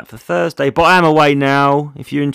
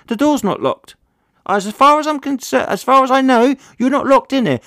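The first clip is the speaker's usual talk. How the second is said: much higher, ringing stage voice telling off a talker; stage put-down; 11 semitones higher than usual. Retold or imitated. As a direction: play it big to the room, mock-patient. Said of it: "I have said this before on stage".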